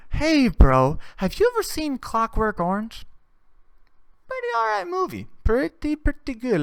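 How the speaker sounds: mock casual recommender. Imitating a friend casually recommending a disturbing movie, light and breezy. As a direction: pitch it like a cheerful movie tip. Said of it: "You can't be like".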